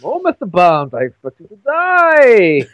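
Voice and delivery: hammed-up vaguely German Bond villain; vaguely German accent. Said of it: "he never had a Scottish accent. He had a vaguely German accent".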